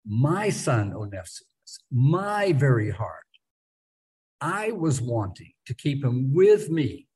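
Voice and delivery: slow emphatic reading, leaning hard on "my" and "I"; emphasis on MY, I. Shown as a demonstration. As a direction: stretch "My", "my" and "I" slowly and weightily. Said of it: "The emphasis was here too".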